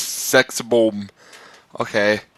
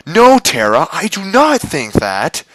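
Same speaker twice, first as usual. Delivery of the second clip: indignant denial; 6 semitones higher than usual. Real, not acted. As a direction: push back at the note. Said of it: "reader rebuts the note".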